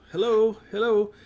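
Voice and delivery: high, friendly hello called to a distant worker; calling out nicely. Retold or imitated. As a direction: call hello up high and polite. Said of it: "I'm trying to say it nicely".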